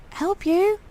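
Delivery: high, soft question; wary disbelief. Pretend. Player asking the twin what help, high and soft. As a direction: ask warily.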